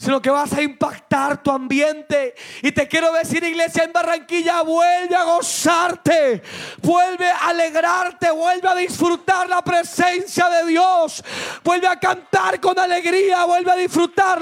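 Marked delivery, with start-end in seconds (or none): 2.6-14.4 slow, stretched, ringing preacher's call; rousing exhortation. Real, not acted. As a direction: rouse the church with drawn-out commands. Preaches at full force. (exhortación encendida y alargada)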